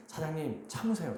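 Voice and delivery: softly imitating an old man's earnest plea; a touch of an elderly man's pleading. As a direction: gently beg him to hold back, quietly. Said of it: "정말 간곡하게".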